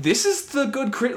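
high-pitched disbelieving inner voice. Retold or imitated.

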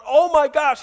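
high, loud gasp of excitement; excited gossip. Acted. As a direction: burst out with thrilled gossip. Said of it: "people were really kind of going".

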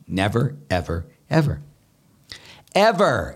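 stack the evers, rising each time; building emphasis. Real, not acted. Priest insisting God is never outdone, rising emphatic.

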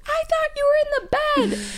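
high, tearful wail; sobbing high cry. Retold or imitated. Wife retelling bawling at her husband, high and tearful. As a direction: cry it out in relief. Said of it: "and I'm like".

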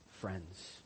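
softly. Real, not acted. Scripture quote ending very softly.

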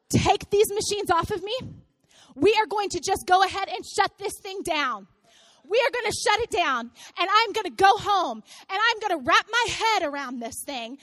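loud, emphatic retold demands; defiant orders. Mother in labor calling off the birth, loud defiance. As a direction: lay down the law, acted up for the crowd. Acts out ordering staff to stop.

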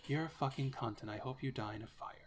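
very soft, hushed mock-soothing murmur; quiet ASMR voice. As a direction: deliver an insult like gentle ASMR. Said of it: "quiet, happy tree, A S M R voice".

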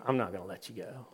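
low, soft, firm dad; calm fatherly refusal. Retold or imitated. Dad holding his son's hand in the water, quietly firm.

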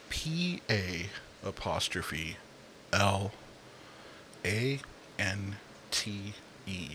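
slow, letter by letter; spelled out. Shown as a demonstration. Spelling the HBO category title letter by letter, slowly. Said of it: "I'm gonna spell it out".

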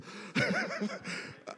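high airy burst of laughter; real laugh. Real, not acted. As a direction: break into a short laugh at the joke. Laughs at own joke.

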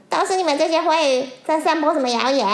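loud fast accusation; accusing. Acted. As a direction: fling the blame at them. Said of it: "黑色鱼说".

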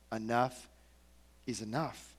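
leise und gedehnt "Enough is enough"; hushed, drawn out. Shown as a demonstration. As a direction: let each word sink in softly.

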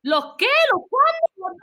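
high retold disbelief; baffled. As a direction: pitch climbs in confused protest.

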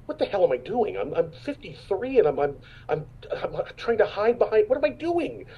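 high-pitched, stammering self-questioning; retold self-disbelief. Actor recalls asking himself what he is doing, high incredulous voice. Retelling his exasperated thought.

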